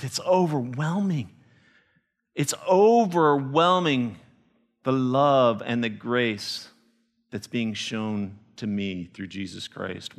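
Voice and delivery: Copa overwhelmed, slow, dropping to a hush. Acted. Copa's tearful answer, voiced.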